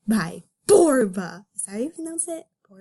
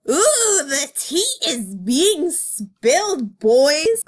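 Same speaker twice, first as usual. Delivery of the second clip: gossipy glee; 5 semitones higher, 5 dB louder, much slower than usual. Real, not acted. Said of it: "gossipy commentary".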